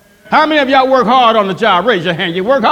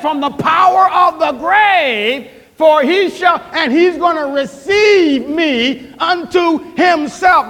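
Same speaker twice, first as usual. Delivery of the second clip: higher, slow, building preacher's cry; preaching climax; 4 semitones higher, slower than usual. Acted. Pastor proclaiming God redeems the soul, rising preacher's cry.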